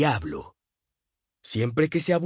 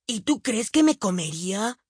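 put-on young boy voice; 9 semitones higher than usual. A narrator's reading. Billy asks.

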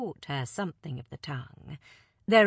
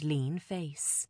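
usual speaking voice

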